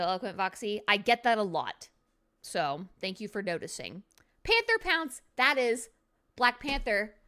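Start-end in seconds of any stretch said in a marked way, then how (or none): 4.4-5.2 high card announcement. Acted.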